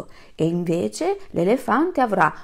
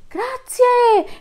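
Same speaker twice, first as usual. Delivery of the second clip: much higher, louder cheerful thanks; animals' chorus; 7 dB louder than usual. Acted. Animals thank their king together, high and loud. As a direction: squeal it like a crowd of animals. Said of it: "risposero in coro gli animali".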